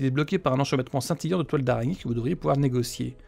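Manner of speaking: dramatic reading of eerie events; game narration. A narrator's reading. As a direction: read it like an ominous storyteller. Reads game text aloud.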